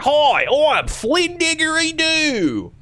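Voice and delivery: very high, drawn-out Australian holler; over-the-top slow Australian greeting; horrible Australian accent. Retold or imitated. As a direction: belt out a terrible Aussie host intro. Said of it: "doing a horrible Australian accent".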